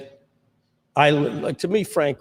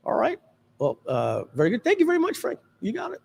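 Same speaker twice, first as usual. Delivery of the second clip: much higher, lightly mocking Frank wrapping up politely; Frank Kern imitation; 10 semitones higher, 3 dB quieter than usual. Guest imitating Frank Kern dismissing a lady at an event, high mock voice. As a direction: send the fan off in a high, breezy mock voice. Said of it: "He goes".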